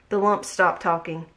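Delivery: plain reading voice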